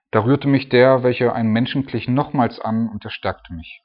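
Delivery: loud, solemn reading of the verse; scripture reading. Acted. Preacher reading the third touch aloud to the church. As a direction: lift the words up from the page.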